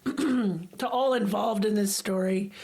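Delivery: ordinary talk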